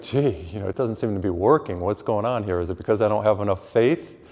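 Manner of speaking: ordinary delivery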